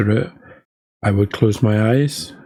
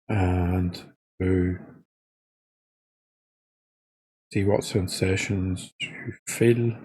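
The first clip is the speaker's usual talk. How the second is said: soft, unhurried body-scan guidance with long pauses; guided meditation; 6 dB quieter than usual. Real, not acted. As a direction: talk them gently into noticing each body part, quietly.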